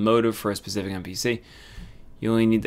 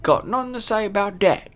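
high-pitched, hammed-up Australian accent; bad Australian accent; 10 semitones higher, 3 dB louder than usual. Player voicing a game character's reply, bad Australian accent. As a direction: put on a loud, bad Aussie twang. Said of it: "That's a bad Australian accent".

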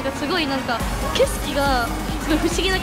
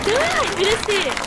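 excited; 6 semitones higher than usual. Real, not acted. Thrilled after the ride.

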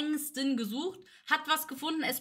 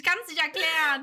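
slow, words squeezed out through laughter; real laughter; laughing while talking; 4 semitones higher, 5 dB louder than usual. Real, not acted. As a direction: try to talk but keep cracking up.

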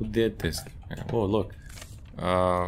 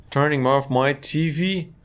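usual speaking voice

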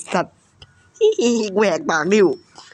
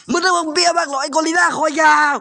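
higher, shouted taunt; mocking shout; 6 semitones higher than usual. Real, not acted. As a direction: jeer at the long-necked gorilla. Taunting the monster.

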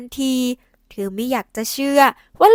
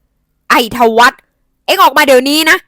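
loud furious summons, drawn out; angry yell; 10 dB louder, slower than usual. A narrator's reading. Angry aunt calling her son out, loud furious yell. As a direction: bellow his name in rage. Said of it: "เสียงของป้าพิษดังขึ้น".

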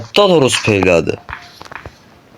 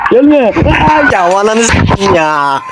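terrified screaming. Real, not acted. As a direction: scream in real terror, pleading it to stay away. Hunted, screaming at the chaser. (korkuyla çığlık atarak)

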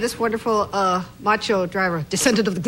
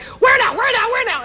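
high panicked yell of where now; frantic shouting; 12 semitones higher, 6 dB louder than usual. Retold or imitated. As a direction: shout it over and over, lost and frantic. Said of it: "But it was like".

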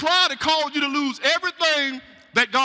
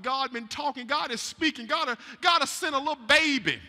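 ordinary talk, nothing marked

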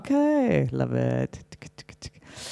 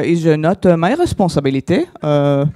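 mock French accent, louder, halting; 4 dB louder than usual. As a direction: imitate a French speaker fumbling English. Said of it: "they're always like".

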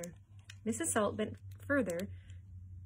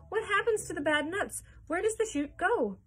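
high, loud frantic questions; shrieking; 10 semitones higher, 5 dB louder than usual. A narrator's reading. Mother flapping her arms at the factory owner, shrieking. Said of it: "shrieked Missus Salt".

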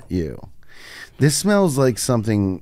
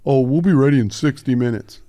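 mocking friend; 3 semitones higher, 3 dB louder than usual. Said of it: "like you guys do to me".

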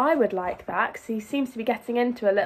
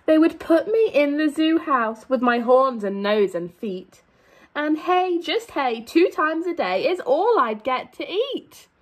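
lively, sing-song storybook read; animated picture-book reading; reading to children; 2 semitones higher than usual. A narrator's reading. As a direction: read it out to a class of little kids.

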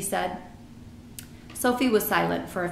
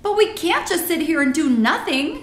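urgent, higher and louder protest; Sophie crying out; 9 semitones higher, 6 dB louder than usual. A narrator's reading. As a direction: cry it out in helpless protest. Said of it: "Sophie cried".

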